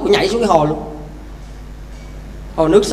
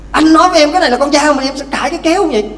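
higher and fast, hammed-up scolding; husband berating his wife for arguing, in a fast mock rage; mock furious husband; 6 semitones higher, faster than usual. Husband scolds after slapping wife.

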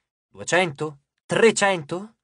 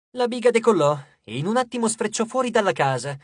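ordinary delivery, nothing marked

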